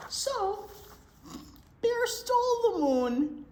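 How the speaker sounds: high, loud storytelling announcement; dramatic reveal. A narrator's reading. Librarian revealing the theft to the children, high and loud.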